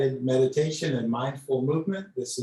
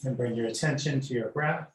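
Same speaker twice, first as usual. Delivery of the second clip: guided meditation. Acted. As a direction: ease the class into noticing the breath. Leads breath meditation.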